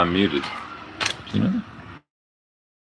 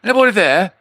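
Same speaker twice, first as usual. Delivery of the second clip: high-pitched put-on British accent; mock British; 8 semitones higher than usual. Testing the mic for listeners, in a mock British accent. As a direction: play up a British accent for the test. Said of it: "I turn British".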